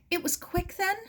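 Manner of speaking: a grieving woman's high, polite question. A narrator's reading. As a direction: keep it polite and high, holding back grief. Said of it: "in a high, polite voice".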